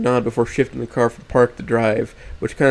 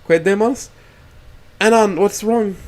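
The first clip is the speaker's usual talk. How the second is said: high, louder startled question; alarmed and puzzled from the front seat; confused tone; 9 semitones higher, 5 dB louder than usual. Acted. Rosa stops the car and asks Anan, confused and alarmed. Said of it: "asks with a confused tone".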